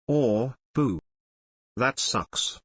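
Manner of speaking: usual speaking voice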